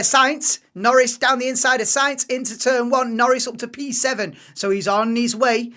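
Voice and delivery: loud, excited live race call; motorsport commentator. Acted. Live calling of an overtake.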